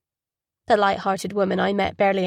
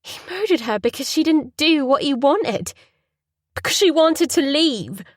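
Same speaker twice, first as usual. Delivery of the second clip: rising anger; 6 semitones higher, 5 dB louder than usual. Acted.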